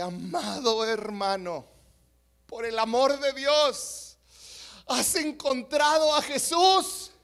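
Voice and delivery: intense pastor. Acted. Pastor to the drunk after the longest dunk, intense. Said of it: "el pastor se pone más intenso".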